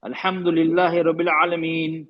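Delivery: even, measured tilawat; steady recitation with tajweed; Quran recitation. Shown as a demonstration. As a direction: recite it as a model for students. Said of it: "अब मैं example दे रहा हूँ".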